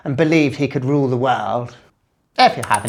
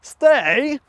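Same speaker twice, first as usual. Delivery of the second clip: shouted catchphrase; 9 semitones higher, much slower than usual. Acted.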